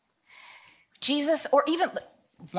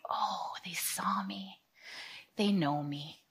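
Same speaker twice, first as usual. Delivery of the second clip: awed whisper; 2 semitones lower, 8 dB quieter, slower than usual. Retold or imitated.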